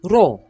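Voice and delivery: ro with a tapped rolled R; second syllable. Shown as a demonstration. Teacher isolates the second syllable, tapped R. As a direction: tap the R in ro. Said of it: "Second syllable".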